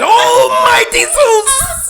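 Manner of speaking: slow, high, loud mock sob; crying out. Co-host imitates the Lion crying over Dorothy, loud mock wail. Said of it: "he gets all emotional".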